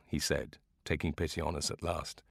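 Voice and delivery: ordinary delivery, nothing marked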